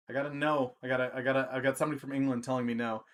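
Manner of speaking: normal talking voice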